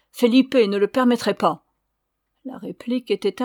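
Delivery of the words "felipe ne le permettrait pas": loud protest; offended cry. A narrator's reading. Ramona invoking Felipe against the signora, offended cry. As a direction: insist forcefully, affronted. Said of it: "s'écria-t-elle".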